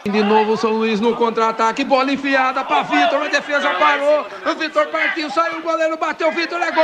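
football commentary building to a goal shout; live play-by-play. Acted. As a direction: call the attack rising to GOL.

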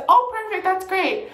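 sugary customer voice. Retold or imitated. Customer pleased with flour.

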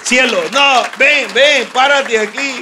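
loud, high call across the room. Real, not acted. Husband calling his wife Claudia up beside him, loud. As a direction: call her up to you, bright and loud.

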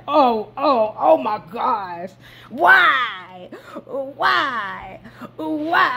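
high, wailing oh my gosh and why, breaking into a laugh; hammed-up near-tears lament; mock crying wail. Fan reliving Boromir's death, hammed-up tearful wail. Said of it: "I was about to cry".

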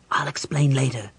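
hushed, breathy aside; whisper. Acted. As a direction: whisper it so the crowd can't hear. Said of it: "Raistlin whispered to Caramon".

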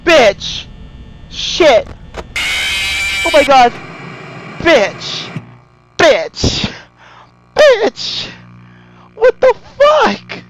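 loud, high bursts of swearing; real panicked shouting. Real, not acted. Gamer caught by Chica, panicked shouting. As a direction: yell each curse, climbing to a shriek. Jump-scare, cursing at game.

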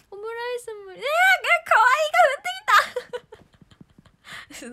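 high, loud squeal with laughter; delighted squeal. Real, not acted. Squealing as the cute omurice arrives. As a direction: swoon over the cute food.

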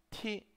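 "T" said as English "tee"; aspirated "T", pronounced "tee"; English-style T. Shown as a demonstration. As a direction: release "T" with a puff of air, as in English tea. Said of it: "de, en inglés".

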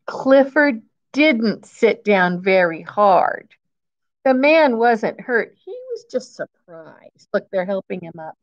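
ordinary delivery, nothing marked

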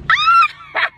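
a high, loud scream of joy at the new smile; excited scream. Real, not acted. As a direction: shriek with delight.

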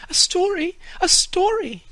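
put-on high, loud eager children's voices; children crying out. A narrator's reading. Children pulling the man to the tree, begging eagerly. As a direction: beg for a story like excited kids. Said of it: "cried the children".

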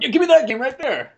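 high, loud pointed order; showy demand. Acted. Customer to clerk while kid watches, showy loud demand. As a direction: announce it so everyone nearby hears.

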